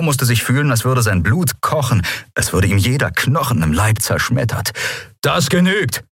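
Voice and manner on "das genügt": high, sharp cry to stop it; called out. A narrator's reading. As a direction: cut him off with authority. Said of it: "rief Telios".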